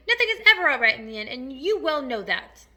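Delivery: crush the optimism crossly; scornful cry. A narrator's reading. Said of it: "cried the earthworm".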